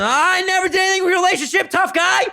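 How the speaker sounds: fast, very high imitated yell; hammed-up outraged denial; mock furious protest. Concussed Mike getting up to face Ronnie, mock furious.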